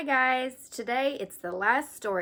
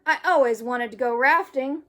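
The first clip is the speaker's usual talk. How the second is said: very high loud joyful whoop; 5 semitones higher, 11 dB louder than usual. Acted. Arthur riding the river, very loud high whoop. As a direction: whoop with glee. Thrilled rafting.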